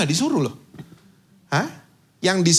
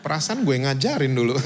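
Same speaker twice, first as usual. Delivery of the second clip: ordinary talk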